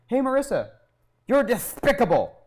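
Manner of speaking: high, loud cartoon-duck catchphrase; Daffy Duck. Boy trying to impress his crush, hammed-up cartoon impression. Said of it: "impression of Daffy Duck".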